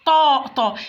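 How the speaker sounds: "طاء" with a heavy, mouth-filling t; emphatic taa. Shown as a demonstration. Teacher demonstrates the emphatic letter, full dark "طاء". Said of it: "ده حرف مفخم".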